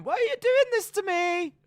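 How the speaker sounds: loud, very high squealed plea; Mandy Rose impression. Reply to a bully, loud squealed mock plea. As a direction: wail it in a mock falsetto. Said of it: "just Mandy Rose on the floor going".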